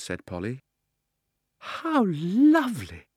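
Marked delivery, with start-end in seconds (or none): 1.6-3.1 high, drawn-out slow "How lovely!"; girl thrilled by the rings; delighted girl. Acted. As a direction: gush over it. Polly delighted.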